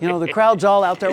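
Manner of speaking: ordinary delivery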